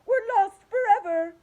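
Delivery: high, loud hopeless cry; despairing shout. A narrator's reading. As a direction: cry out the doom. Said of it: "shouted Ladybug".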